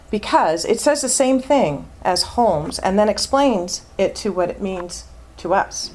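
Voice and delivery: ordinary delivery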